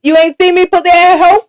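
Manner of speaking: high, loud exaggerated Miami accent; hammed-up Miami accent, calling her out. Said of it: "your Miami accent".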